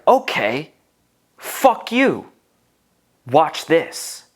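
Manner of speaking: loud, high defiant outburst; defiant snap. Retold or imitated. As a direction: spit it at him in defiance. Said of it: "I was like".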